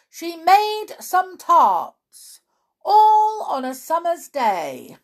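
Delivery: slow, loud herald's recital; formal proclamation. A narrator's reading. Rabbit reading the accusation to the court, slow loud proclamation. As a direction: draw out each line, high at first and gradually settling. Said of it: "and read as follows".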